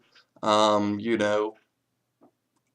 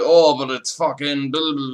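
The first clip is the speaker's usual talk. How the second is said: mocking critics; 5 semitones higher, 6 dB louder than usual. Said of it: "some people might be like".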